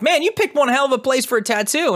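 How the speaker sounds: a hint of the shop owner teasing; owner's remark. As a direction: toss it off like an amused tattoo shop owner. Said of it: "he's like".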